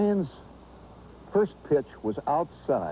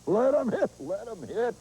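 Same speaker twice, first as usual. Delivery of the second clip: first baseman hollering to his pitcher from the bag; hollered taunt; 11 semitones higher than usual. Retold or imitated. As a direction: chatter from first base like a cocky fielder.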